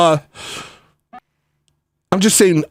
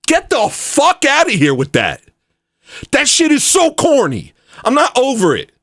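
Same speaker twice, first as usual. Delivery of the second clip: high-pitched cursing outburst; angry rejection; 10 semitones higher than usual. Real, not acted. Streamer rejecting the over-it attitude, angry. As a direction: throw it out, worked up and high.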